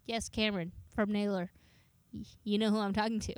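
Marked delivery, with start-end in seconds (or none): none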